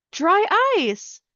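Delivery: put-on high-pitched storybook child voice; girl answering. Girl answers the scientist's question, high child voice.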